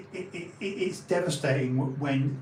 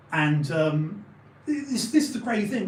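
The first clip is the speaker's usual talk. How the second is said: ordinary talk, nothing marked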